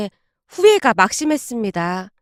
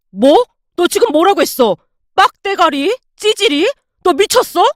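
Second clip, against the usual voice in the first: outraged, higher, louder shout; shouting back; 5 semitones higher, 3 dB louder than usual. Acted. Husband blocking her way, shouting back outraged. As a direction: yell back in disbelief at the insults. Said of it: "남편이 제 앞을 막아선 채 소리쳤습니다".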